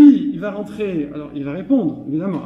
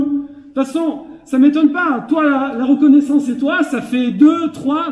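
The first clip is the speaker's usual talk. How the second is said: higher-pitched, exasperated husband; playfully acted domestic grumbling; mock aggrieved reproach; 6 semitones higher than usual. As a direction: act out a resentful spouse's jab. Acted husband in marital quarrel.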